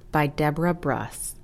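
usual speaking voice

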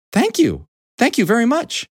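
high-pitched hen thanks; grateful hen. A narrator's reading. Hen thanking Mister Horse, grateful high voice.

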